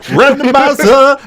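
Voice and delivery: high, loud, long-drawn comic cry; mock battle cry. Doctor escaping in his tardis, mock triumphant cry. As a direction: belt it out slowly as an imitation.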